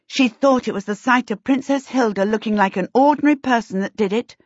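usual speaking voice

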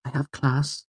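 high, light female character voice; reply in a high woman's voice; lifted, gentle feminine reply; young woman's voice. A narrator's reading. Narrator voices Fela replying.

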